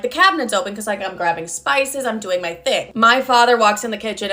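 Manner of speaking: usual speaking voice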